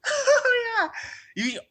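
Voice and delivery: a sudden high, loud squeal of delight; delighted yelp. Real, not acted. Excited squeal at the idea of a drunk Let's Play. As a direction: squeal at the thought of it.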